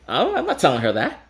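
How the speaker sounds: high laughing. Real, not acted. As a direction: laugh at your younger self. Laughs at himself.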